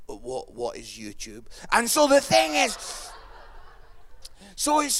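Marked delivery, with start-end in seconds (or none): 0.1-1.5 low, soft puzzled voice; clueless audience member. Acted. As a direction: play someone baffled, low and quiet.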